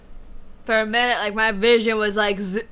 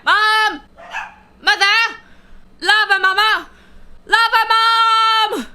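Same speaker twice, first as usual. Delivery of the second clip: loud high call across the house; 10 semitones higher, 4 dB louder than usual. Real, not acted. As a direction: shout for mom from afar. Calling mother from another room.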